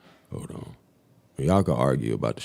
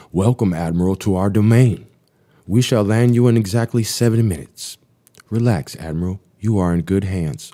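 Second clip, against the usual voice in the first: steady, slightly raised log-reading voice; diary reading; 5 semitones higher, 6 dB louder than usual. Acted. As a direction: read the flight log like a pilot's report. Reads radio message from log.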